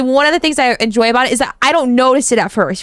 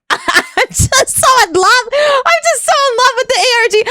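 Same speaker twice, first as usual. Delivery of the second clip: much higher, laughing and gushing; giddy gushing; 11 semitones higher than usual. Real, not acted. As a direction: gush with delight. Gushing over the ARG.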